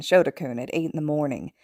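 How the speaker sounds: ordinary delivery, nothing marked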